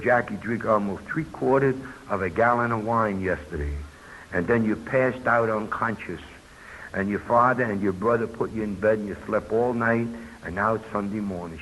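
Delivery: low, soft, mildly retold reproach; lightly acted, subdued motherly reproach; grieving mother. Crying mother reproaches her son.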